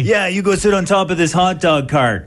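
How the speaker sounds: high put-on heckler voice; mock heckling. Imagined player heckles the umpire.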